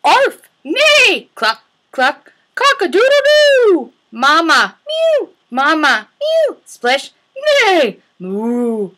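loud, varied animal noises, squeaky high for birds; bark, bleat and tweet each animal in turn; animal sounds. Acted. Teacher voicing farm animals for toddlers.

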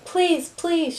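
high, pleading voice; an earnest plea without extra volume; begging. A narrator's reading. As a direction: beg for it, pitch lifted. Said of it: "she begged".

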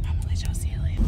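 breathy secret whisper. Shown as a demonstration. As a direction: lean in and whisper it like a teaser. Whispered repeat of previous line.

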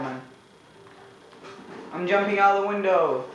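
usual speaking voice

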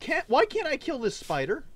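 high, loud exasperated whine; frustrated yell. Real, not acted. Frustrated at unkillable spider.

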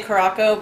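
Caraco said as "kuh-RAH-ko"; common mispronunciation. Shown as a demonstration. Vlogger shows viewers what some people say, mispronouncing Caraco with a "ko" ending. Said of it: "real quick on the pronunciation of this".

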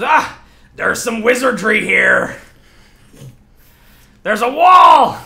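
high, loud cry of alarm; alarmed enemy shout. Acted. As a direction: yell it out to the whole crew. Game master voices alarmed enemy.

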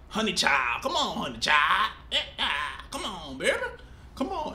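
lightly voiced southern mammy accent, coaxing; parodying the cartoon's southern mammy voice to the baby; mock southern mammy accent. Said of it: "Have that real stereotypical mammy southern accent".

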